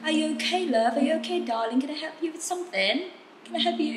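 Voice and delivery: kindly British "Can I help you?"; British accent, helpful and friendly. Retold or imitated. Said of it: "Mereka kan orangnya ramah".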